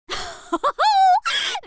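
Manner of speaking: high, loud giggle; delighted laugh. Acted. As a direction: burst into a thrilled laugh. Excited teacher.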